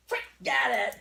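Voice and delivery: loud go-signal to a dog; excited release command. Real, not acted. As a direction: shout it to launch the dog. Sending dog to reward.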